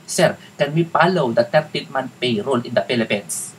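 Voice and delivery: English with a heavy Tagalog accent, f turned to p; thick Tagalog accent. Shown as a demonstration. Virtual assistant asking a client for holiday pay, heavy Tagalog accent. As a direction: lay on a Tagalog accent on purpose. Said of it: "Gawin Tagalog talaga. Yung Tagalog na accent".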